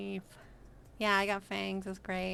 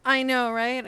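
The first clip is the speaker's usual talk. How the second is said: excited; 4 semitones higher, 14 dB louder than usual. Real, not acted. Excited agreement with chat.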